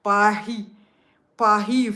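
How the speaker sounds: "Paris" as pa-HEE, silent s; French-style pronunciation. Shown as a demonstration. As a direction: attempt a French r in "Paris", almost an h. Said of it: "Not Paris".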